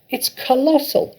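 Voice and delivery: very high, loud, rising with joy; an overjoyed farmer dancing round and round; ecstatic shouting. A narrator's reading. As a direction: build the wonder word by word. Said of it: "Mister Cranky shouted".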